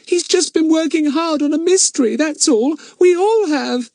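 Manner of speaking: Bets pleading, high and choked up; a little girl begging, voice catching; choky voice. A narrator's reading. Bets pleading with the superintendent, high and choky. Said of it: "in a suddenly choky voice".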